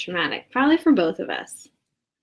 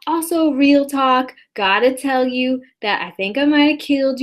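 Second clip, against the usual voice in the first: higher, louder dude-bro voice reading the text; reading an all-caps text; 5 semitones higher, 3 dB louder than usual. Acted. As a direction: bro voice shouting the message out. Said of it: "This is in all caps".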